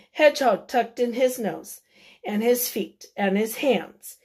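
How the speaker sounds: plain reading voice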